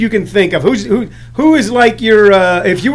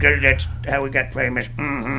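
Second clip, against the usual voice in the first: very low, quiet character mumble; Sling Blade guy; 8 semitones lower, 10 dB quieter than usual. Acted. Comedian replying as the film character, low quiet grunting voice. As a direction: answer deep and muted, ending on a grunt. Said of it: "Sling Blade guy".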